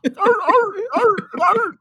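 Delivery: high, yelping circus-seal barks; mock seal barks while covered in fluff. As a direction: bark like a performing seal. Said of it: "like a circus seal".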